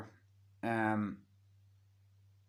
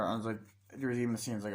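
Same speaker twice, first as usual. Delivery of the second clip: ordinary talk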